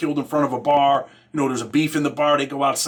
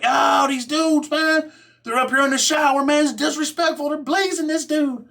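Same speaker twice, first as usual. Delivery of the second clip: mock whiny complainer; 12 semitones higher than usual. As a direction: ham up the outraged snitch. Italian kid complaining in quote.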